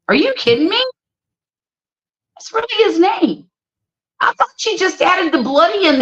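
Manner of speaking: can't believe it's his real name; genuine surprise. Real, not acted. Host learning a singer's real name, surprised.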